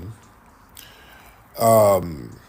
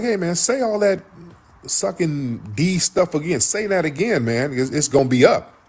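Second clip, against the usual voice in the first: pitched-up mock dare; retold threat; 7 semitones higher than usual. Corey warning Anton not to repeat it, retold threat. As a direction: imitate someone daring a rival, voice raised in pitch.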